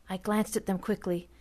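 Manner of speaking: ordinary delivery, nothing marked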